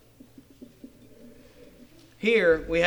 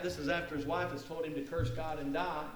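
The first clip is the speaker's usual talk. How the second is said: ordinary talk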